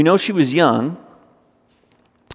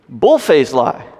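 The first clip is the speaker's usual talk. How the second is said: ordinary talk, nothing marked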